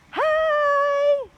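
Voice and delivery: very high, very loud cheerful shout; shouted greeting. A narrator's reading. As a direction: call out big across the distance. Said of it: "Annie shouted".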